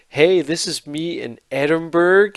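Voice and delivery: slow American TikTok presenter impression; American vlogger. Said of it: "It was the American guy doing a".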